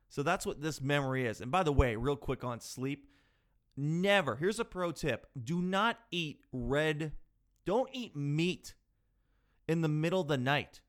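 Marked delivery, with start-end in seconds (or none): none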